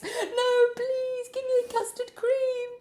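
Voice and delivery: high, begging whine; hammed-up plea; mock pleading. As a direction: beg the devil for a biscuit. Pleading victim in hell.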